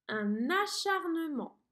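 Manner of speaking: acharnement stretched slowly, syllable by syllable; dictation slow. Shown as a demonstration. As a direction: dictate the word slowly and clearly. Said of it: "Quatre syllabes". (acharnement dicté lentement)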